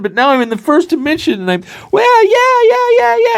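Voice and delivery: high-pitched, thrilled little guy; giddy amazement. Acted. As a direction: squeal with mind-blown glee. Johnny marvels while running back and forth.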